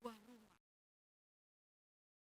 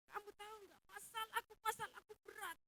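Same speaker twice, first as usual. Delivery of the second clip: higher and louder, whining about a heavy problem; exaggerated complainer; 9 semitones higher, 8 dB louder, faster than usual. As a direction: ham up a complainer airing his burden. Imitating people who vent their problems. (mengeluh dengan suara tinggi dan keras)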